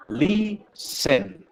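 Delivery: LISTEN split in two, LI high, SEN dropping; first-syllable stress. Shown as a demonstration. Teacher shows where the accent goes, LISTEN stressed on LI. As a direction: raise the voice on LI of LISTEN, then fall. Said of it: "Ahí subo la voz y bajo en listen".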